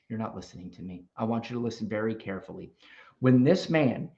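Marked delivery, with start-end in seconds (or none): 0.1-2.7 much softer, lower and pointed; quiet lecturing. Real, not acted. Host hushing the caller to make him listen, softly.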